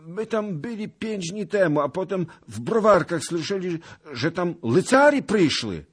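high, loud, wailing account; frightened old beggar. Acted. Beggar confesses to the lord about arriving knights, frightened. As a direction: blurt it out in a scared high voice.

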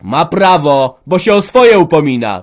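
high, very loud angry retorts; yelling ever harder. A narrator's reading. Son defends his wife against father, yelling. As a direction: build the shout with each phrase. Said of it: "wrzeszczał coraz mocniej Antek".

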